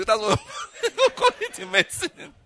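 words breaking into high laughter; amused, pitch leaping up with laughter; laughing. Real, not acted. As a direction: laugh through the punchline. Laughs at his own medical joke.

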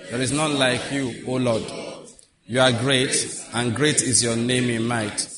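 slow, measured congregational recitation; scripture reading. Acted. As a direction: lead the congregation through the verse, slowly and solemnly. Leads congregation in reading scripture together.

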